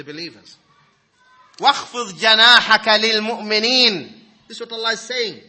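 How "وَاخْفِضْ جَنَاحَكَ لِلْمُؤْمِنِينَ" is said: loud fast recited verse; Quran recitation. Acted.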